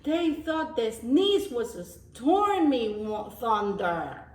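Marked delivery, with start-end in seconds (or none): none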